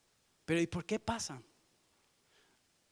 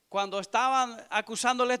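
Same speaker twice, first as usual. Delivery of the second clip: ordinary talk, nothing marked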